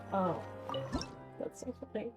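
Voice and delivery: ordinary talk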